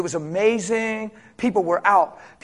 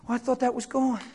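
a touch of softer, fretful worry; mock panicked worry; 3 semitones higher, 6 dB quieter than usual. A believer fretting over a memory, softer and anxious. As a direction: whimper it out like a worried believer.

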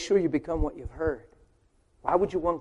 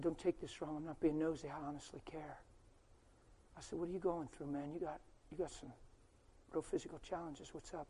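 hushed retelling; 20 dB quieter than usual. Retold or imitated.

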